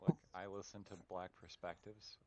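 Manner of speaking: ordinary delivery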